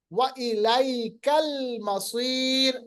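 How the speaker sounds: slow, melodic tajweed chant; Quran recitation. Shown as a demonstration. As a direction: recite it unhurried, stretching the vowels. Teacher recites Quran words.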